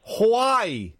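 Hawaii drawn out as 'hoo-WHY', high; insisted pronunciation of Huawei. Shown as a demonstration. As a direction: say Hawaii long and high, 'hwa-eye'. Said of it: "back to the phone".

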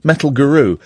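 normal talking voice

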